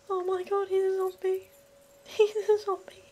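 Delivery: high panicked shrieks. Real, not acted. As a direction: panic as it sinks in. Panicked discovery of zombie.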